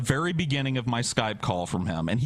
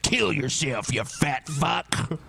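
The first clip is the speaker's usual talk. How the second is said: mock cruel taunt; slower than usual. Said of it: "I'm not gonna be like".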